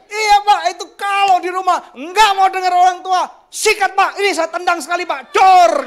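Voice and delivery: high, loud shouting agreement; harsh parent shout. Acted. Parent shouting to the shop owner to beat the child, loud harsh shout. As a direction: yell it like a parent eager to punish.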